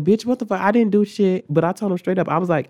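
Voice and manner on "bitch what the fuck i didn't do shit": high, loud protest of innocence; indignant denial. Retold or imitated. Accused student answering the assistant principal, indignant denial. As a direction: deny it instantly and loudly.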